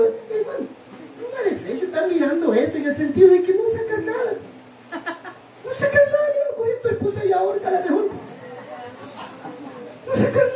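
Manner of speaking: very high, squealing voice breaking into laughter; mock him through helpless high-pitched laughs; laughing mockery. Real, not acted. Bromea burlándose del que mira. (burla entre risas, con voz muy aguda)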